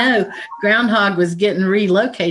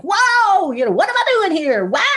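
much higher, fast, panicky squirrel; frantic squirrel voice; 11 semitones higher than usual. Retold or imitated. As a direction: squeak it out like a caffeinated squirrel. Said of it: "It's like".

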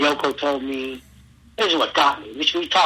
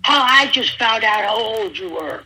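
imitating Cornette; 7 semitones higher, 4 dB louder than usual. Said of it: "he goes".

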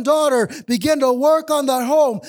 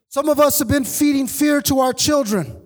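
usual speaking voice

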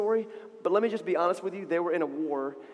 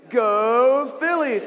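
loud, long drawn-out "Go"; crowd cheer; 4 semitones higher, 6 dB louder, much slower than usual. Shown as a demonstration. Storyteller teaches kids the Philistines' cue, cheering. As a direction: cheer like a fan in the stands. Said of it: "people cheering for them".

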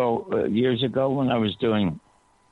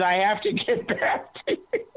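laughing; 10 semitones higher than usual. Real, not acted.

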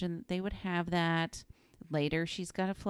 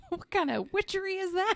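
high-pitched comic alarm; mock shocked outburst; 4 dB louder than usual. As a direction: act scandalized by sorcery, pitched way up. Acting out Dorothy's shock at lights.

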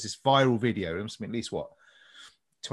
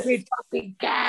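sudden high yelled insult; hammed-up angry yell at a driver; mock shout; 11 semitones higher than usual. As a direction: bark it out in mock rage.

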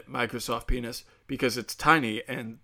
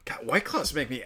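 ordinary talk

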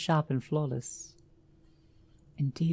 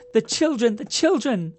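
high, loud desperate yelling; frantic scream; 8 semitones higher, 8 dB louder than usual. A narrator's reading. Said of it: "I kept screaming".

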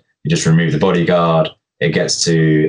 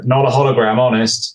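higher-pitched sheepish quote; mock-innocent, sheepish protest; 7 semitones higher than usual. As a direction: lightly play someone caught out and bluffing. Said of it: "look around sheepishly and go".

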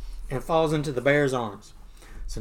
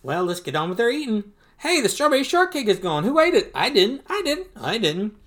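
lightly voiced, higher-pitched excited youngsters; excited duck nephews; 6 semitones higher, 4 dB louder than usual. Nephews telling Uncle Donald about missing shortcake, lightly acted excitement. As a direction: voice excitable little ducklings, pitched up.

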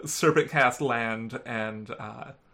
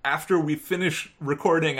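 ordinary delivery

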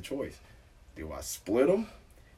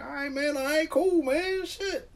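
high squeaky mock-meek voice; mock meek compliance; slower than usual. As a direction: sound small and harmless, high. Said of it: "I'm like".

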